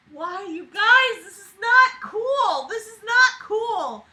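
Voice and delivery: high, slow wailing complaint; whiny protest. Real, not acted. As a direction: plead it in a high whine.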